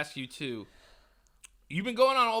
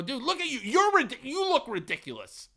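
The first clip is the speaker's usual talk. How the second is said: retold angry retort; 7 semitones higher than usual. Retold quarrel with clerk.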